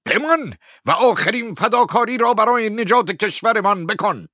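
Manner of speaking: high, shouted plea to stay; minister's loud, high-pitched entreaty; loud appeal. A narrator's reading. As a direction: cry out an urgent appeal at full voice. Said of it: "با صدای بلند گفت".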